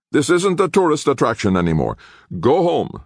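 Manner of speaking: loud, high-pitched order to leave; angry dismissal. A narrator's reading. Guard turns visitors away from the temple, angry dismissal.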